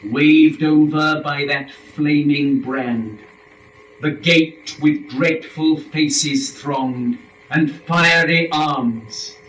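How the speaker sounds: slow, ringing epic narration; dramatic swell. A narrator's reading. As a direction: paint the dreadful gate. Narrator evokes the flaming gate.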